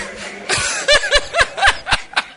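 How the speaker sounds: long, high-pitched peal of laughter; high laughing fit. Real, not acted. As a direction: crack up helplessly at your own joke. Preacher cracks up at joke.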